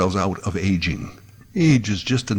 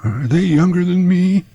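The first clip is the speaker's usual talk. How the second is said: put-on higher, louder old caller voice; old man voice; 9 semitones higher, 4 dB louder than usual. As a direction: sound like an elderly radio caller. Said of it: "those guys with the old voice".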